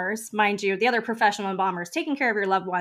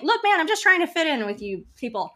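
high-pitched, louder self-defence; exasperated plea; 8 semitones higher, 5 dB louder than usual. Acted. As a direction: protest to the boss, acted out. Acted exasperated plea to boss.

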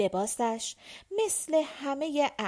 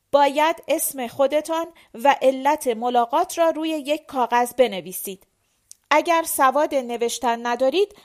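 loud, forceful instructions; loud official addressing petitioners; officious guard; 3 semitones higher, 9 dB louder than usual. A narrator's reading. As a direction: lay down the rules at high volume. Guard loudly dictates the procedure.

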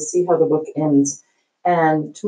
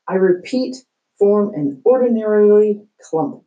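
bullhorn principal; 5 semitones higher, 4 dB louder than usual. Acted. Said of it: "Principal Barkin had a bullhorn".

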